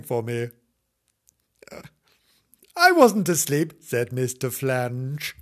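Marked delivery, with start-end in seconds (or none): 2.8-3.7 indignant husband. Acted. Husband protesting.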